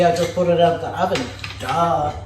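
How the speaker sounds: ordinary talk, nothing marked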